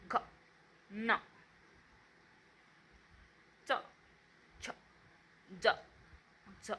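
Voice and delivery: each consonant from क to ळ cut very short; isolated stopped consonants, barely any vowel after each; vowelless consonants. Shown as a demonstration. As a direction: recite क, ख, ग one by one, clipped, with pauses. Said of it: "त्याचा उच्चार कसा होतो ते आपण पाहूया".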